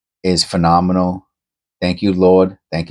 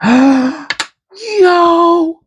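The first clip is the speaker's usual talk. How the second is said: real excited shout; 5 dB louder than usual. Real, not acted. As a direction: gasp then a high, loud yell. Finds Indian head penny.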